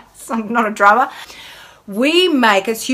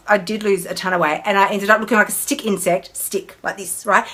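usual speaking voice

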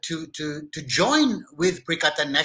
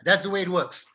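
normal talking voice

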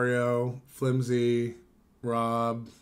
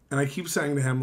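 usual speaking voice

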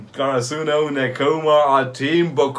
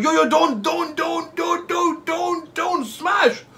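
high-pitched anxious pleading; tense pleading; 11 semitones higher, slower than usual. Real, not acted. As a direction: beg the player not to. Pleading during a rally.

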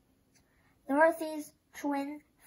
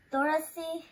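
usual speaking voice

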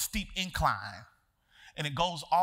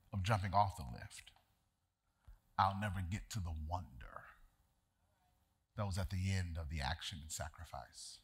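soft, low reflective sermon voice; preacher turning the story into a quiet lesson; quiet preaching; 12 semitones lower, 8 dB quieter than usual. Acted. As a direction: draw them in gently, almost hushed.

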